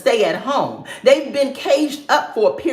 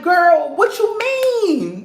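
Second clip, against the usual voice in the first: high, drawn-out disbelief; incredulous girl; 7 semitones higher, much slower than usual. Retold or imitated. Talking back to a clueless TV character in disbelief. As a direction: ask it like she can't believe it. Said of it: "I'm like".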